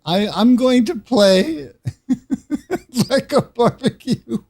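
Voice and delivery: words squeezed out between laughs; laughing through words. Real, not acted. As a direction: keep talking while cracking up. Laughing fit over ketchup bath.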